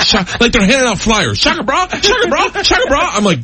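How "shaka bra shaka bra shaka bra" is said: put-on high, excited kid voice repeating a greeting; squeaky imitation of his daughters shouting a greeting; mimicking kids.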